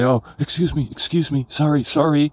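mock over-polite, lifted voice, apologizing repeatedly; polite Canadian apologizing. Knocked-down movie crowd apologizing to the man elbowing them, mock polite. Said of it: "represents that politeness".

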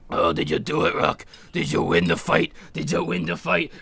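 low, weak old trainer, barely voiced; Mick. Mick asks Rock about the fight, low croaky impression. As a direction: put on a faint, croaking voice low in the throat. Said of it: "and Mick's like".

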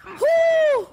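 loud, very high sustained whoop; real triumphant gamer cheer; excited whoop. Real, not acted. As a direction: whoop over a kill. Cheers a game kill.

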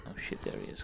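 startled whisper. Real, not acted. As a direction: whisper in sudden alarm. Sneaking, spots the enemy.

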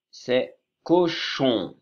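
cochon étiré, syllabe par syllabe; très lent, dicté. Shown as a demonstration. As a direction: dictez cochon très lentement. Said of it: "dictée de mots". (cochon très lent pour la dictée)

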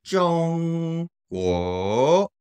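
long flat high ZHONG, rising GUO; tone demonstration. Shown as a demonstration. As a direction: sustain a high ZHONG, then let GUO climb. Teacher repeats for practice.

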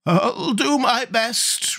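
high, slow horse voice; cheery, high creature voice; cheerful sawhorse. A narrator's reading. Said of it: "replied the sawhorse cheerfully".

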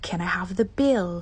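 the whole request whispered slowly. Shown as a demonstration. Asking a waiter for the bill in a whisper. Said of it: "unvoiced whisper".

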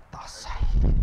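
whispered aside. Real, not acted. Pastor whispering an aside to the congregation. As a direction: breathe it out, unvoiced.

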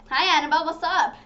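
mock greeting. Shown as a demonstration. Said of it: "That's not what I do".

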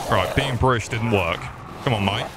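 usual speaking voice

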